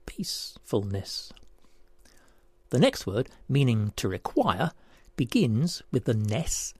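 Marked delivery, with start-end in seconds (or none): 0.1-1.3 "peacefulness" slow, low and soft; syllable by syllable. Shown as a demonstration. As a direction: reveal "peacefulness" slowly and quietly, part by part. Puzzle answer shown by syllables.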